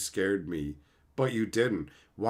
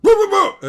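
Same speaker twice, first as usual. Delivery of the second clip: very high, loud yappy barks; dog bark imitation; 14 dB louder, much faster than usual. Imitating the dog that barked in the film, loud high barks. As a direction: bark like the dog slamming the fence. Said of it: "a dog jumped against a fence and went".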